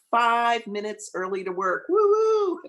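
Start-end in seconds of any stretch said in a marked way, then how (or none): 1.9-2.6 a high, loud, gleeful woo-hoo; joyful whoop. Retold or imitated. Minister retelling her early morning, gleeful whoop. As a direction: cheer yourself on like you just won.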